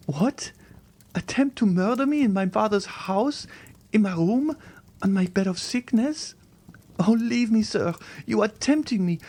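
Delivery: put-on high, pleading young woman's voice; a terrified girl refusing to believe; frightened young girl. A frightened young Valentine begging the Count to leave her.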